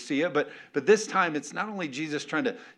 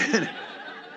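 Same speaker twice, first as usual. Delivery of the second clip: breathy laugh; 4 semitones higher than usual. Real, not acted. Laughs at himself.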